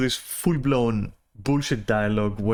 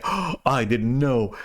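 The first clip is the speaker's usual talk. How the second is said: mock innocence; slower than usual. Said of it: "or be like".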